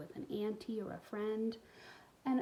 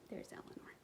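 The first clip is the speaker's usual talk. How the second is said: whispered aside; 14 dB quieter than usual. Real, not acted. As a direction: lean in and whisper it.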